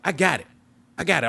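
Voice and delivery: high-pitched, defensive retort; retold irritated insistence; irritated brush-off. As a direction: wave off help, voice jumping up. Retold brushing off dad's help.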